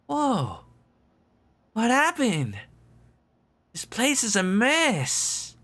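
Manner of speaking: put-on surprised kid in a wrecked lab; bright, high and startled; high young character voice. Voices the game's dialogue.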